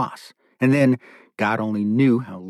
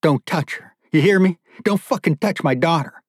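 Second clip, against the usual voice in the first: higher-pitched, protective warning; angry warning; 6 semitones higher than usual. Acted. Chained father warning captor off his daughter, angry. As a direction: warn him off your child, voice rising.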